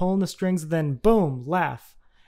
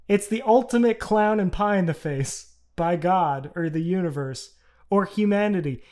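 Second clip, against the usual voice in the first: ordinary delivery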